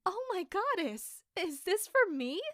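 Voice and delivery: light up at the gift; delighted surprise. Acted. Maid thrilled by her farewell surprise from friends.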